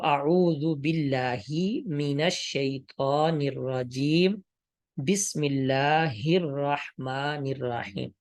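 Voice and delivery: slow, measured Quran recitation with thin "oo" and "ee" vowels; maroof recitation. Shown as a demonstration. Teacher models the corrected reading, slow maroof recitation from اعوذ to الرحیم. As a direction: recite اعوذ باللہ slowly as "a'oozu billaahi". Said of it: "ہر حرف کو معروف پڑھا جاتا ہے".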